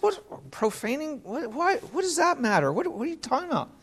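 high, puzzled questions, slowed on profaning; mock bafflement. Preacher imitating people puzzled by the idea of profaning, high and baffled. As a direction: play the clueless listener who can't see the point.

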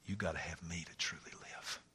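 hushed, voiceless and intimate; whisper. Acted. Jesus answering people's cravings in a whisper. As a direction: whisper it like a secret. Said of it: "comes down and says".

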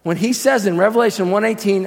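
normal talking voice

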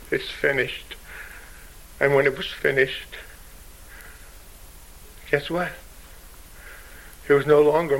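soft, hushed words after the climax; hushed awe. Real, not acted. Preacher hushing the congregation after the climax, soft and awed. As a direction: let it fall to near silence.

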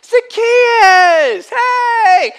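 loud high call across a crowd. Acted. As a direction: shout his name warmly from a distance. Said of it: "Jesus welcomed him".

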